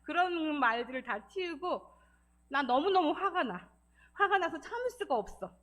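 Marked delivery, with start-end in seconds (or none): 2.5-5.5 firm, raised voice stating anger; expressing anger. Shown as a demonstration. Counselor demonstrating how to voice feelings, emphatic anger. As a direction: put the anger plainly into words, pitch lifted. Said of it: "이렇게 감정 표현".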